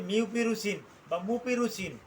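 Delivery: মিউপিরুচিন বা মুপিরুচিন said slowly, each syllable clear; teaching doctors to say মুপিরুচিন right; correct pronunciation. Shown as a demonstration. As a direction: spell out মিউপিরুচিন and মুপিরুচিন carefully. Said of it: "উচ্চারণটা কিন্তু এরকমই হবে".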